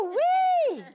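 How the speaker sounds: high, loud laughing woo-wee; excited whoop. Real, not acted. Mother laughing about her lively little daughter, high and loud. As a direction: burst out with delight at the memory.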